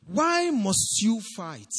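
very slow, weighty "Why must you fight?"; slow rhetorical question. Acted. Preacher questioning the congregation, slow and weighty. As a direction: stretch every word for weight. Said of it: "rhetorical question repeated".

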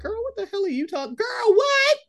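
put-on high, fast girl what; incredulous. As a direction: squeal it in disbelief, rising. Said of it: "His third tweet is like".

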